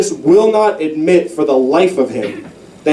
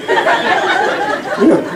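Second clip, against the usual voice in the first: laughing. Real, not acted. Laughing at own joke.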